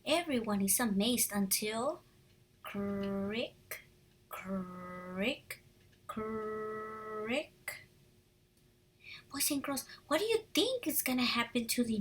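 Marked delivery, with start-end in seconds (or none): 2.6-7.5 creaking. A narrator's reading. Creaking before collapse.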